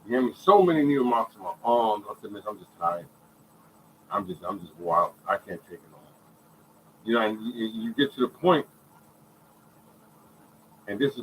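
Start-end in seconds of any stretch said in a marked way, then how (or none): 2.3-6.1 low, soft, worn-out plea; understated weary surrender; retold breakdown. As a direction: quietly admit you've had enough. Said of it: "I just broke down".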